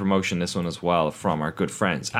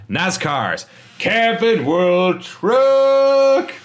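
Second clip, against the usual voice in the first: loud, slow, higher hyped announcer call; announcer yell; 11 semitones higher, 6 dB louder, much slower than usual. Acted. Host hyping the race listings with a loud, slow announcer call. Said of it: "trying not to make this microphone peak".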